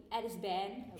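ordinary talk

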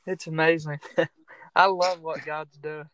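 words broken up by happy laughter; real chuckling delight. Real, not acted. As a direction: laugh with amazement as you say it. Laughs in delight at God's work.

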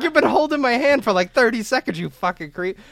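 high-pitched mock outrage, settling down by the end; indignant retort. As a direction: act out a kid's indignant protest. Acted indignant retort to stranger.

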